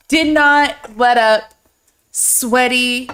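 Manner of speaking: usual speaking voice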